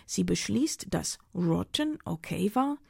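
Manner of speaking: plain reading voice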